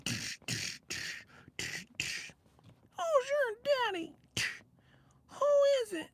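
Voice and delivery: high falsetto mock seduction; squeaky media voice. Imitating a media guy in bed, squeaky falsetto. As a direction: coo it in a ridiculous tiny squeak. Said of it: "sound like that and shit".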